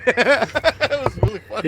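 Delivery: loud, high, slow through laughter; laughing. Real, not acted. Friend recalling a gamer's freak-out, laughing. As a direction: laugh hard through the words. Laughing at own retold story.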